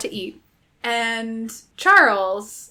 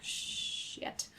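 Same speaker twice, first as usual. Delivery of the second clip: very soft, drawn-out hushed curse; dismayed whisper; 20 dB quieter than usual. Retold or imitated. Boyfriend learning he missed dinner, whispered mock dismay.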